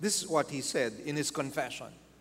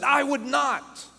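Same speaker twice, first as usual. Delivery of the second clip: high, loud, slow indignant refusal; scowling refusal; 9 semitones higher, 5 dB louder than usual. Retold or imitated. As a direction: act out an offended woman refusing firmly. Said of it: "This woman scowled at".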